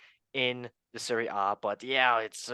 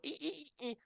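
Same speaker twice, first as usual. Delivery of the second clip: high, softer repeated squeaks; tiny high "ee" noises; funny creature noise; 10 dB quieter than usual. Acted. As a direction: imitate a little game creature squeaking. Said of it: "that was a funny noise".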